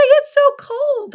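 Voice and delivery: "It's so cold." shouted very high and loud; excited exclamation. Shown as a demonstration. Teacher showing how an excited sentence sounds, very high and loud. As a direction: let your voice go way up on "It's so cold.". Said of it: "Your voice is gonna go up".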